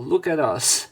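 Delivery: ordinary delivery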